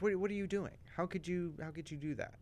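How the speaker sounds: mock furious disbelief. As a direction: imitate an appalled relative. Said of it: "everyone is furious".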